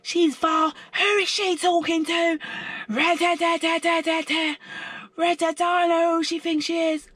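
very high, sustained mock rant; mock screaming. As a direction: mimic her screeching on, words dissolving into jabber. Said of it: "All I hear is even more".

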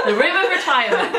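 repeating the joke through a laugh. Real, not acted. Echoing her friend's joke while laughing. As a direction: laugh out the words.

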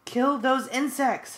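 loud, high, slow furious scream; screamed order. A narrator's reading. Alien leader screams at guards, slow furious high scream. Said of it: "Visser three screamed".

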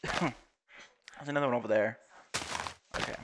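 usual speaking voice

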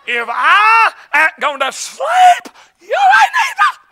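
high, drawn-out comic holler; playful threat bellowed at a friend; mock outraged shout. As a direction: rise to a squealed yell on the last words. Mock threat to his travel companion.

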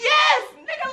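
very high-pitched excited yes; retold high, worked-up confirmation; emphatic agreement. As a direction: squeal the agreement out.

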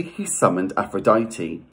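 plain reading voice

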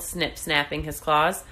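ordinary delivery

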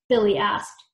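ordinary delivery

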